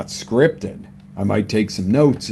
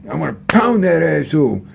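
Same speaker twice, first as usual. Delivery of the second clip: mock angry; 5 semitones higher, 4 dB louder than usual. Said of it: "Different feeling than".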